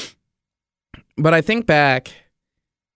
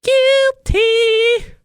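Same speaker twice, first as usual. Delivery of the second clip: squeaky guilty admission. Real, not acted. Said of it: "Victoria's Secret one, runway".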